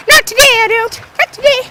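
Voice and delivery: put-on very high little-boy voice; defiant kid. Cousin locked in bathroom refuses, high defiant kid voice. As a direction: imitate a stubborn kid, squeaky high.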